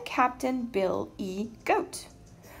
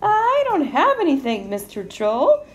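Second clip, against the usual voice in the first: high, loud little goat; 6 semitones higher, 7 dB louder than usual. A narrator's reading. Little goat with no toll to pay, high little-goat voice. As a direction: protest high-pitched as the smallest goat. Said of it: "said the little billy goat".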